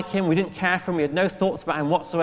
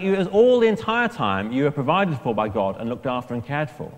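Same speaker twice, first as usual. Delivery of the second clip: usual speaking voice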